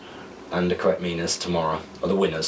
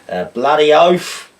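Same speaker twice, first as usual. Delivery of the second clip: slow, loud, higher Australian drawl; Australian accent; 7 semitones higher, 8 dB louder, slower than usual. Pretend. Trying Aussie slang for viewers, drawn-out Australian accent. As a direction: stretch bloody oath out, Australian accent. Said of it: "decipher these Australian slang terms slash words in an accent".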